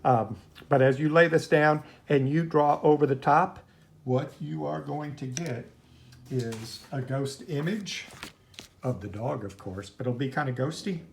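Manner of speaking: usual speaking voice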